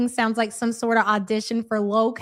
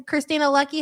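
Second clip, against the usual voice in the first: ordinary talk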